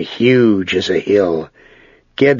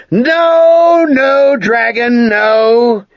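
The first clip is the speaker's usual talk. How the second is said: shouting; 5 dB louder, much slower than usual. Acted. Ged refuses the dragon.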